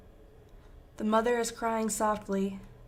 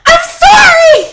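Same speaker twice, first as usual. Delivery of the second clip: high, desperate, full-throated scream; anguished scream; 24 dB louder, slower than usual. A narrator's reading. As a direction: scream an apology at full force. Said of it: "he screams".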